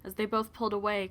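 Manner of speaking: ordinary delivery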